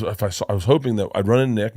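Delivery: usual speaking voice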